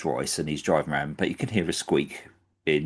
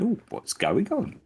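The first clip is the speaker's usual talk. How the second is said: mock-suspicious police, much higher; a policeman's raised, puzzled question; curious police. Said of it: "police notice ... and going".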